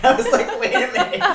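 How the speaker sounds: high, loud laugh into the quote. Retold or imitated. Laughing about stopping the sparring. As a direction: crack up on the line.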